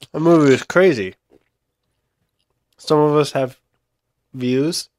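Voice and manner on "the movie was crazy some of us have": ordinary talk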